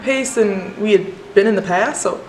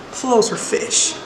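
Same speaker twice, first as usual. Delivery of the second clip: normal talking voice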